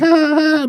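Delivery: high, repeated fake shrieks of laughter; mock girly cackle. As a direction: imitate girls still cackling. Same girls laughing months later.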